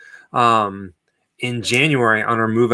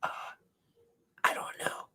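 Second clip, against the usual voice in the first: whispering; 17 dB quieter than usual. Pretend. Whispered hiding game.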